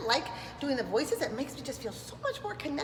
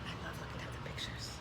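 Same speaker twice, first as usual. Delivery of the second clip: hushed whispered aside; soft secret whisper; 14 dB quieter, faster than usual. Real, not acted.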